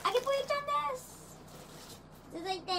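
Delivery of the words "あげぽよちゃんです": high, fast gyaru chirp; japanese gyaru slang. Pretend.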